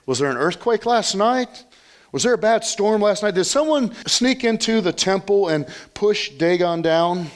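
high-pitched, mock-bewildered questioning; imagined puzzled discussion. Townspeople wondering about the fallen idol, mock-bewildered and high. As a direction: fire off baffled questions, voice lifted high. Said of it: "Could you just imagine the discussion".

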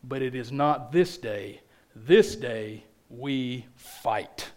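low, soft and very slow; hushed resolve, each word weighed; king's battle speech. Acted. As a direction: draw out the final vow quietly. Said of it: "He rides out in front of them and says".

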